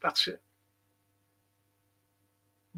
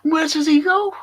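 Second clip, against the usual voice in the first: mocking taunt; 12 semitones higher, 6 dB louder than usual. Real, not acted. Taunting rhetorical question.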